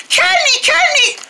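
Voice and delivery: high, urgent calls; calling out. Acted. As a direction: call the name high and quick. Calling after departing friend.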